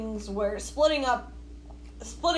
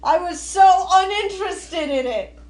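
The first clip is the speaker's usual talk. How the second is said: exasperated outcry; 6 semitones higher, 6 dB louder than usual. Real, not acted. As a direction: wail it like a wronged fan. Mock outrage over missed dragon.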